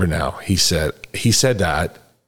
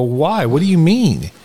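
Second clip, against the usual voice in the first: higher, louder retold bafflement; puzzled questioning; 9 semitones higher, 4 dB louder, slower than usual. Sponsee asking sponsor why he's different, puzzled. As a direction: re-enact pressing for an explanation.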